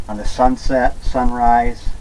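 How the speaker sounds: normal talking voice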